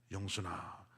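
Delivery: low, soft voice of God asking; a hint of a divine voice. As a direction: ask gently from above, low. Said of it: "물어보시더래요".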